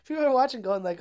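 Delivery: ordinary talk, nothing marked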